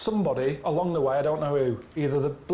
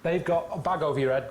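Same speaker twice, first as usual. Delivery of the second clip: ordinary talk, nothing marked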